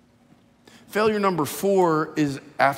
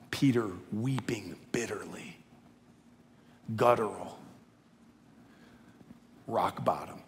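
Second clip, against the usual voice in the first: whispered, low and soft; hushed, grieving; 5 semitones lower, 7 dB quieter than usual. A narrator's reading. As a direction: let it sink to a hush. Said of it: "dramatic narration of weeping".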